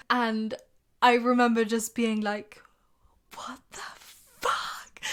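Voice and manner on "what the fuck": softer, breathless whispered disbelief; stunned whisper. Retold or imitated. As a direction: whisper it, too shocked to say it aloud. Remembers her stunned reaction.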